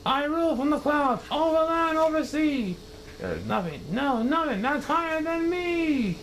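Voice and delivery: high, proud declaration; haughty king. Acted. Yertle boasting to Mac, high and haughty. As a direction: lord your height over everyone.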